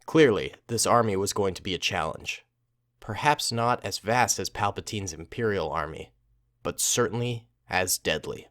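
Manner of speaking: low, measured story reading; story narration. A narrator's reading. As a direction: narrate the tale in a low, even voice. Reads the story aloud.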